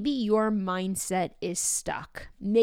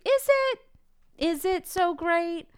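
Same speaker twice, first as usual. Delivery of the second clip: sceptical; 8 semitones higher, slower than usual. Real, not acted. Doubting the workout praise.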